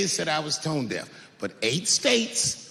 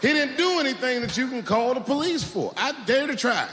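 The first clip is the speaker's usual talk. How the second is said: usual speaking voice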